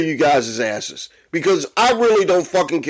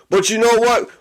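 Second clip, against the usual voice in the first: normal talking voice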